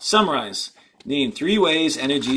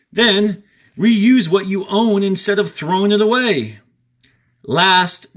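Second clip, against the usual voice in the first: louder and higher, stressing each step; emphatic reading; 6 semitones higher, 5 dB louder than usual. Shown as a demonstration. Teacher stressing each step to students, loud and high. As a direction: punch each step for the class. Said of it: "reading the three R's steps".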